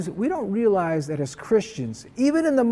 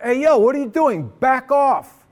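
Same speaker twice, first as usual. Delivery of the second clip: retold yell, higher and louder; acted-out warning shout, raised pitch; yelled challenge; 8 semitones higher, 5 dB louder than usual. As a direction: call out sharply to stop someone, re-enacting it. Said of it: "I yelled out".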